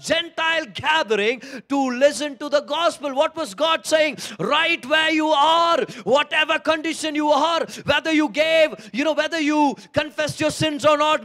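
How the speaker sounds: loud, high fervent preaching; rising, pounding sermon delivery; preaching at full force. Acted. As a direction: build it up like a revival preacher.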